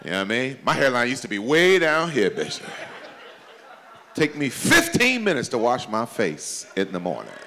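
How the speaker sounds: ordinary talk, nothing marked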